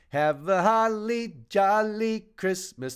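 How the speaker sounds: slow crooning imitation; mock crooner. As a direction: croon it slowly like a Christmas singer. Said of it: "The way he says that".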